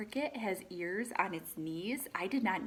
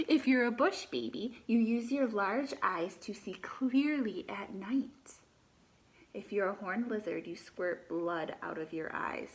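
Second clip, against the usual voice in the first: lively read-aloud, animal names lifted; reading to children; 4 dB louder than usual. Acted. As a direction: read the book to the class, naming each animal brightly. Teacher reads picture book aloud.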